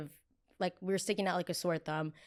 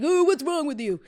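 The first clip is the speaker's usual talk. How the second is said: high, loud scoffing mock voice; higher, louder put-on disgust; mocking LA native; 9 semitones higher, 9 dB louder than usual. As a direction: sneer it like an offended local. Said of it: "L A natives are gonna be like".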